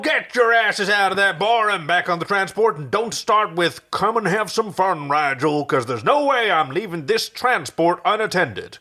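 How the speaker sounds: loud, high bellow at the crew. Acted. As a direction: yell orders across the bar. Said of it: "Crichton bellowed".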